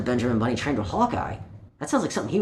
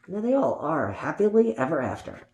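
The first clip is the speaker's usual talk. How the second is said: usual speaking voice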